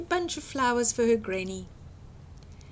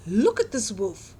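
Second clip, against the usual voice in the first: ordinary delivery, nothing marked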